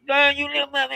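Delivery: high, nasal angry voice; mock her furious voicemail; nasal angry woman. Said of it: "she was talking through her nose".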